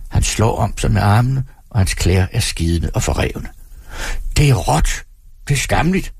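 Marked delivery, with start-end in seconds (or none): 4.4-6.1 raised, fast indignant protest; furious at the crowd; indignant outburst. A narrator's reading. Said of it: "hans øjne gnistrer". (harmfuld protest)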